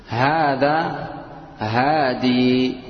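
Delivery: هادي drawn out very slowly, ending in a long ii; pausal form with yaa. Shown as a demonstration. Teacher showing the allowed alternative pausal reading, slowly. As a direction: stretch هادي with a long final ii. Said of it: "يجوز أن تقول".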